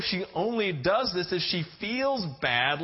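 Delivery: normal talking voice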